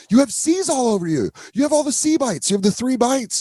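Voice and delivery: hammed-up alarm, voice way up; freaked out. Coworker warning Julia about bites, high panic. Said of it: "this other girl fucking freaked out".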